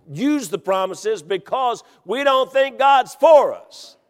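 rising preacher's cadence; building sermon fervor; preaching. Acted. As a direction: preach it with growing force.

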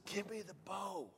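very soft, urgent request; hushed order. Retold or imitated. Hunter asks buddy for the bow, hushed urgency. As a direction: whisper it so the buck won't hear. Secret request, buck nearby.

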